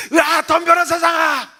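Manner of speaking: high, loud roaring challenge; defiant yell. Retold or imitated. Pastor snapping out of despair, defiant shout. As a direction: burst into a battle yell. Acted sudden battle cry.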